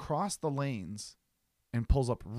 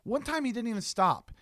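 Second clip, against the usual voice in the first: ordinary delivery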